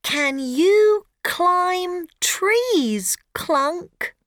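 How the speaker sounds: put-on high, slow little girl; hopeful girl asking; young girl voice. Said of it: "asks Rosie".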